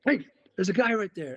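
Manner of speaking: sudden shout spotting a stranger; calling out. Retold or imitated. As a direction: point him out to the team. Spotting a man in the field.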